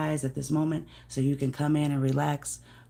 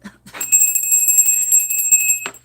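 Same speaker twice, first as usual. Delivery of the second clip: loud squealing laugh, much higher; high shrieking laugh; 7 dB louder than usual. Real, not acted. Laughing at herself for nearly banging the door, shrieking laugh. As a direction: burst into shrill laughter. After "Oh, damn it.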